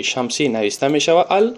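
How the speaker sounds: ordinary delivery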